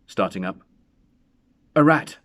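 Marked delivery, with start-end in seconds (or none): 1.7-2.2 high, loud, voice trembling with fear; shaking tones. A narrator's reading. Old man lying to his wife about the knock, shaking. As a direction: cover the terror with a shaky lie. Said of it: "in shaking tones".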